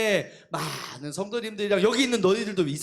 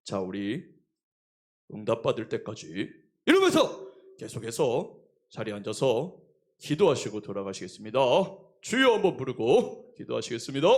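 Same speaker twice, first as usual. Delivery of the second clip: much lower, deeper imitation of the leading pastor; prayer leader voice; 10 semitones lower than usual. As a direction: intone it like a pastor closing prayer. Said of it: "인도하는 목사님이".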